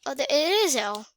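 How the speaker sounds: ordinary delivery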